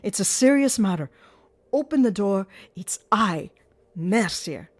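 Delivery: urgent shouting at a locked door; shouted. Acted. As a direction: shout to be let in. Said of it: "I shouted".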